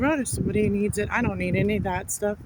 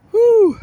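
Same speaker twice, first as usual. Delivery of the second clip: big high exhale, a loud "whew"; 11 dB louder than usual. Real, not acted. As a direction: blow it out loud like relief after effort. Exhausted on the walk home.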